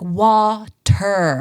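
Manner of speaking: drawn-out, overly clear word; slowly spelled out. Retold or imitated. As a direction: spell it out slowly for someone who can't understand. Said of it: "if she just speaks really slowly".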